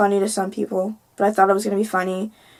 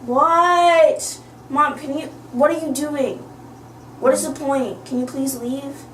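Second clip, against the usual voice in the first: real high-pitched irritated protest; exasperated at mom; 5 semitones higher, slower than usual. Real, not acted. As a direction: shoo mom away, exasperated. Real argument with interrupting mom.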